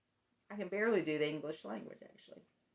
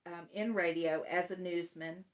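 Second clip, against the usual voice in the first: ordinary talk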